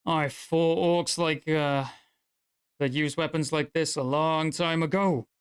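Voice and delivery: mild dwarf voice, slow then steady; lightly voiced disdainful dwarf. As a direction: sneer at the axe like an old war veteran. Dwarf's distaste for orc weapons.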